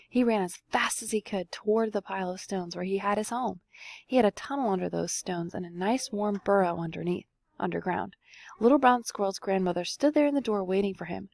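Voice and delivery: ordinary delivery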